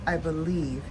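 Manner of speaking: normal talking voice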